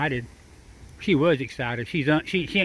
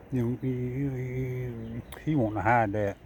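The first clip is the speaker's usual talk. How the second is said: normal talking voice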